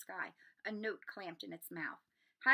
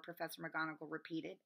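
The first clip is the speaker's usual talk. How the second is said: ordinary delivery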